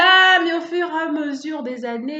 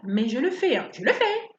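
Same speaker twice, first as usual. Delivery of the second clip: ordinary talk, nothing marked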